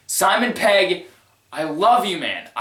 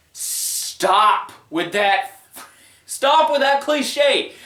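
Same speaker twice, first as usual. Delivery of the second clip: slow, drawn-out words, rising higher on the repeat; mock-despairing slow protest; exasperated plea; 5 semitones higher, much slower than usual. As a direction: stretch it out, fed up with the cliche. Exasperated plea against the cliche.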